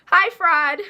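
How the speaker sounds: loud, sassy "Hi, fraud"; mock greeting.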